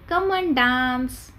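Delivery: drawn-out, slow teacher demo; slow model phrase. Shown as a demonstration. Teacher slowly models the party invitation. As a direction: stretch it out for the class. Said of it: "So what we will say?".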